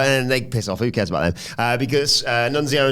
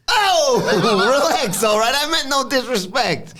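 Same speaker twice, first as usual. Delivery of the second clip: put-on high, laughing Italian-American accent; smooth it over like a wiseguy; mock Italian-American, laughing through it; Tony impression; 11 semitones higher than usual. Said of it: "Tony says".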